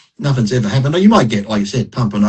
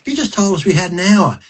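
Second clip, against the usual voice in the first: retold indignant protest; 8 semitones higher than usual. Retold protest at sudden stage call.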